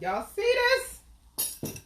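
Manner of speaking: high, slow, drawn-out excitement; excited. Real, not acted. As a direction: stretch it out, thrilled. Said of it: "I'm excited".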